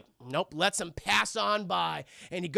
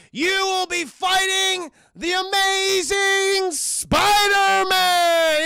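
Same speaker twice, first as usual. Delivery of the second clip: slow, loud, high mock ring announcer shout; wrestling promoter hyping the fight; booming announcer; 10 semitones higher, 8 dB louder, much slower than usual. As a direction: bellow it to the arena. Announcer voice in retold scene.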